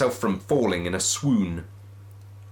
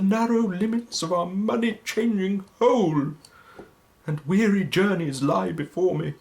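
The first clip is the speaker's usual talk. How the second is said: Marley's ghost lamenting to Scrooge; mournful ghost; 11 semitones higher than usual. Acted. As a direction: wail your fate like a damned spirit. Ghost's long speech.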